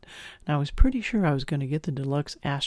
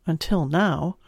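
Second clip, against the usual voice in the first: ordinary delivery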